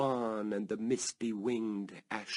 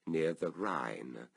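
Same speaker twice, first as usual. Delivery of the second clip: ordinary delivery, nothing marked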